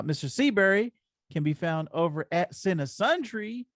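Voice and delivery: ordinary delivery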